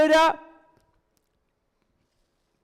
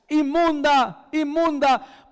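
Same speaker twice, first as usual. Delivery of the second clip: mock cry of unclean, slow and raised; a woman warning the crowd she is unclean; calling out unclean; 5 semitones higher, much slower than usual. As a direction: call out the warning like a leper. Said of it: "ella tenía que gritar".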